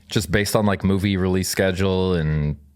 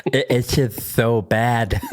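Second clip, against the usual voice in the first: campy voice; 3 semitones higher, slower than usual. Pretend. Friends voicing the pet Zelda, slow camp voice. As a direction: draw it out like a camp diva.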